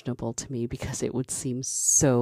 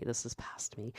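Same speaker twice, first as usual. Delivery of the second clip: whispered aside; 14 dB quieter than usual. Real, not acted. Repeated in a whisper.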